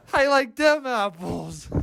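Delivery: put-on high, drawn-out Boston-accent taunt; Matt Damon Boston accent. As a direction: stretch it out in a mock Boston accent. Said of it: "Boston accent is just Matt Damon".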